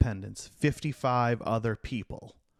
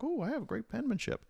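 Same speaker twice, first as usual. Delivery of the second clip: much higher, playful mock boast; Thomas Jefferson; 11 semitones higher, 3 dB quieter than usual. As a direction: act out an eager volunteer in a high voice. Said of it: "But Jefferson's like".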